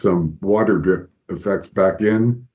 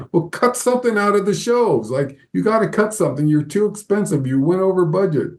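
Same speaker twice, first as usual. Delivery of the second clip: much higher, fast scolding in a put-on voice; mock angry boss; 9 semitones higher than usual. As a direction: imitate the boss scolding over the budget. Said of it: "he's all mad at me".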